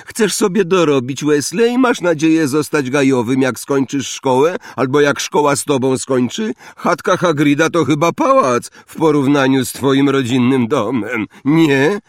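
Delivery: high, loud taunting voice; zimny Malfoy. A narrator's reading. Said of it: "zimny głos Malfoya". (zimny, wysoki, szyderczy głos)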